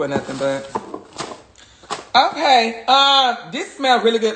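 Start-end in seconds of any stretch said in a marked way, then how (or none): none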